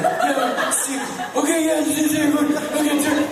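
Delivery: high, loud panicky babble; mock fluster. Kidnapped friend fumbling with his phone, imitated panic. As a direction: fumble through it frantically.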